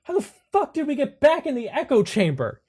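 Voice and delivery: panicked shouting. Acted. Anderson finding himself back in the echo chamber, loud panicked yell. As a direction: scream it out in horror. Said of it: "Anderson is like".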